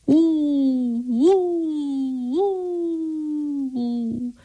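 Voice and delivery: high, long wind howls fading away; howling wind. A narrator's reading. The wind blows through the trees, a high fading howl.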